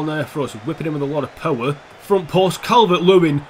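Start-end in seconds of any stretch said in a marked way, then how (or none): 2.0-3.4 loud goal call, building; excited shout. Real, not acted. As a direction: shout the scorer's name. Goal being scored.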